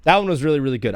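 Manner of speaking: ordinary talk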